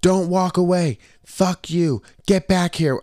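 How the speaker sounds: high-pitched mock yell, little added volume; imitated yelling. Girlfriend following him down the boardwalk, mock yelling. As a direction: mimic an angry girlfriend calling after you, high. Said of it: "Yelling at me".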